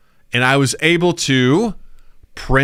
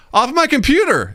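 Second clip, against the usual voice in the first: put-on innocent kid; 11 semitones higher than usual. Naive kid answers teacher.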